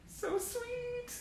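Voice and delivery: very high, soft cooing. Real, not acted. Fan cooing over an old clipping, high and soft. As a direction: melt over a sweet photo.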